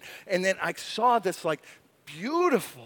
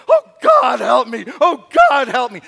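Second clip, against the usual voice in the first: loud, higher crying out for help; desperate cry; 3 semitones higher, 11 dB louder than usual. Retold or imitated. David in the cave crying out to God, loud. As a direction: shout it like a desperate plea. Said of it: "he's going".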